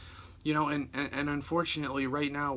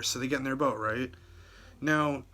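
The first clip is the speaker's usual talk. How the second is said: normal talking voice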